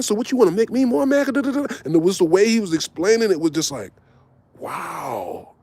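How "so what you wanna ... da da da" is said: high, fast imitated outburst; Brick flipping. Calm pimp suddenly snapping at the girls, high and fast. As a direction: retell his blow-up pitched high and quick. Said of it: "he just flipped".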